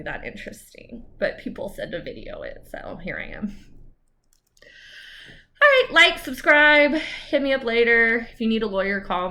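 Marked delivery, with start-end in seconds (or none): 1.2-3.6 whispered aside. Real, not acted. Sheepish aside. 5.6-8.3 upbeat sign-off. Real, not acted. Energetic sign-off.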